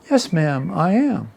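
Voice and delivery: put-on Southern politeness; fake Southern accent. Volunteer replying politely to the old lady, fake Southern accent. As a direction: play along in a mock Southern accent. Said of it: "in my best fake Southern accent".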